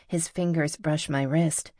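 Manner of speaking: ordinary delivery, nothing marked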